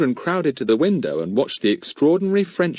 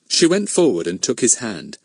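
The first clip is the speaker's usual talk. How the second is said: ordinary delivery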